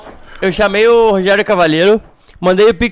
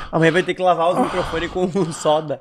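normal talking voice

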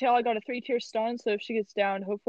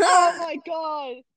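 slow breathless gasp of relief; adrenaline shakes; 6 semitones higher, much slower than usual. Real, not acted. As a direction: heart pounding after a close call. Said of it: "My, my heart is racing".

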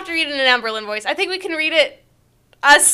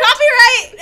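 excited; 10 semitones higher, 5 dB louder than usual. Real, not acted. Echoes the sign-off loudly.

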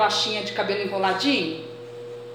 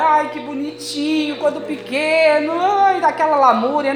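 higher, wistful mock lament; mock tearful cooing; 5 semitones higher, 4 dB louder than usual. Mother crying over her son's baby things, mock tearful. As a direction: coo as if tearing up over keepsakes. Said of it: "Aí chora".

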